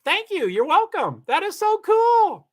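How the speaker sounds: thrilled squeal. Real, not acted. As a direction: squeal with real delight. Thrilled reply to viewer.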